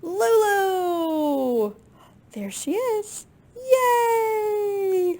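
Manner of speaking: high, loud delighted cheer; joyful cheer. Acted. Reader cheers with the children as Lulu appears. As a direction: celebrate the reunion. Reader greets Lulu's return.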